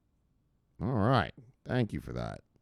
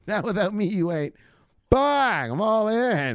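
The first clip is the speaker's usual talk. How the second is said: higher, teasing impression of Lorna; mock Lorna; 8 semitones higher, 3 dB louder, slower than usual. Host replays Lorna's line to chat, pitched-up mock. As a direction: play her as pushy and playful. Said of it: "That's how she did that".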